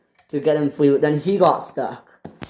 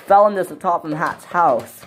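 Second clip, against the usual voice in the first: ordinary delivery, nothing marked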